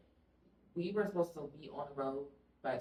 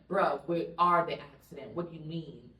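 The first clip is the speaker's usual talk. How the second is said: attitude; 9 dB louder than usual. Retold or imitated. Talking back to the man directing traffic, exasperated. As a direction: snap back at the stranger. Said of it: "I had an attitude with him".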